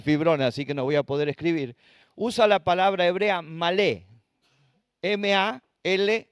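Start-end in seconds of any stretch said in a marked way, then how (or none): 3.4-4.1 malé with stress on the last syllable, "lé"; stress on final E. Shown as a demonstration. Preacher teaches the congregation a Hebrew word, malé stressed on the E. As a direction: land the accent on the E of malé. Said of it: "acento en la E".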